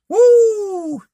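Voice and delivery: high, loud joyful whoop; ecstatic whoop. Real, not acted. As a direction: let out a praise whoop. Ecstatic shout after reading verse.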